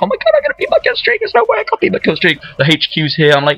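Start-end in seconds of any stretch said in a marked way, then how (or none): 0.0-2.4 very high, rushed gleeful squeal; really happy. Retold or imitated. Gamer reliving his joy nearing a kill streak, giddy excitement. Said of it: "I'm really happy, you don't know how happy".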